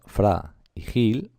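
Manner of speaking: "fra y gil" slowly, each syllable on its own. Shown as a demonstration. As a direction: separate "fra" and "gil" with a pause. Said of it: "son dos sílabas". ("fra y gil" despacio, sílaba por sílaba)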